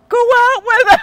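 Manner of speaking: loud, very high imitation of onlookers; crowd urging shout. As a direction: yell it like a whole crowd egging someone on. Acts out onlookers urging her.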